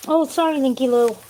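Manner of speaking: a high, loud sarcastic mock apology; mocking imitation of him. Mimicking his apology.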